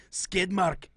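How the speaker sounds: high, hammed-up Scottish brogue; lilting put-on Scots; mock Scottish accent. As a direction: thank them in a broad fake Scottish accent. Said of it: "From Northeast Scotland".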